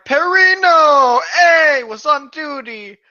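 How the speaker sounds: loud, high, drawn-out hail; calling out. Acted. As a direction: call out to an old friend. Greeting an old friend, called out.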